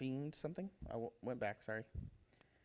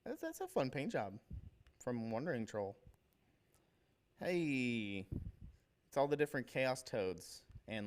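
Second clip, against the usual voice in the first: ordinary talk, nothing marked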